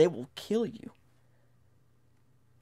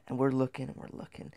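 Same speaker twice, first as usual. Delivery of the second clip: whisper; 8 dB quieter, faster than usual. A narrator's reading. Tense search in dark cave.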